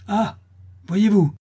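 high, loud indignant cry; wrathful outcry. A narrator's reading. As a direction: cry out as an angry crowd. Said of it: "s'écrièrent les laboureurs courroucés". (cri courroucé)

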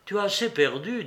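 high, subdued and slightly strange. A narrator's reading. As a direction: gently hold him back in an odd tone. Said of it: "dit Glouchenka d'une voix étrange".

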